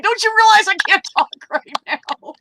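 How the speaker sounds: high, laughing mock exasperation; squeaky laughing disbelief; exasperated laughing. Annoyed retold thought, laughing.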